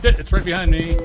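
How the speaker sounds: loud, alarmed exclamations; panicked as the ghost closes in. Real, not acted. Ghost hunting him, speeding up.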